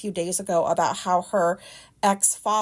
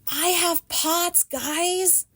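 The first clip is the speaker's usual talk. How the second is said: higher-pitched, drawn-out excited reveal; excited announcement; 8 semitones higher, much slower than usual. Real, not acted. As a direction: announce it with real excitement, stretching the words. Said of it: "the reason I'm excited about this".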